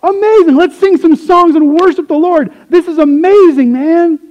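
high, loud ecstatic cheering; overjoyed crowd member losing it with excitement; freaking out. Pretend. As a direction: whoop it up like you just saw a miracle. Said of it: "we'd be, like, freaking out".

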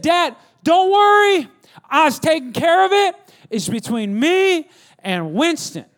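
put-on high, loud, drawn-out teenage retort; acted indignant son; protesting son. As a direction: push back at Dad, stretching the words. Acts older son answering back.

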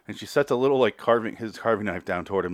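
ordinary talk

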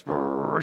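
long lip-trilled engine rumble; truck rumble imitation. Imitating trash trucks rolling up.

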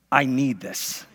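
"I need this" said low and soft, whining; quiet child's plea "I need this"; pleading kid. Retold or imitated. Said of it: "kids are like".

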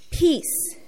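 called out. Acted. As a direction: call out peace across to the king. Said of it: "Ahimaaz called out to the king".

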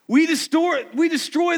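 high, loud proclamation; preaching shout. Real, not acted. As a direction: declare it to the congregation. Preaching at full force.

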